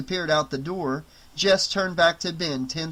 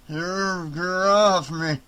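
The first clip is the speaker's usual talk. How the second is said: groggy mutter; 3 semitones higher, much slower than usual. Acted. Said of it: "he muttered groggily".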